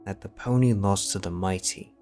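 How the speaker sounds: ordinary delivery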